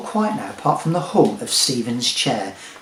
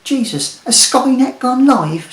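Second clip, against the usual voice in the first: shocked disbelief; 10 semitones higher, 7 dB louder than usual. Acted. Woman shocked by robot voice.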